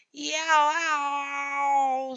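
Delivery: high, loud yowling tomcat; cat yowl. A narrator's reading.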